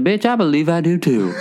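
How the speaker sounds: Southern trucker impression, laughing after; Southern accent. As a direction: retort in a mock Southern accent. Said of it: "like, a Southern accent".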